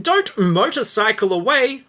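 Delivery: high pleading voice. Acted. Read line in character voice.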